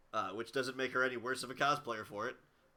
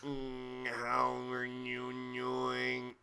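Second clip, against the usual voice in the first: very slow, monotone robotic drone; haunting, stretched-out robot speech; much slower than usual. Shown as a demonstration. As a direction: hold one pitch like a talking machine. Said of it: "I can do this voice super well".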